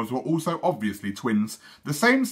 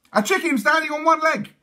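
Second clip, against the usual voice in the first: high silly twin voices; 6 dB louder than usual. Acted. Twins giving silly answer.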